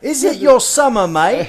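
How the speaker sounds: loud, high mock Australian; bad Australian accent. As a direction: holler in a hammed-up Aussie accent. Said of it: "That's a horrible accent".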